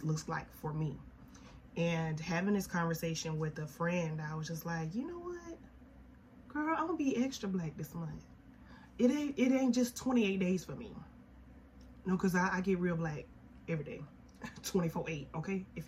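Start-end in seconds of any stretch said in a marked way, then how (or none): 4.9-10.9 sassy quote. Retold or imitated. Quoting herself to friend.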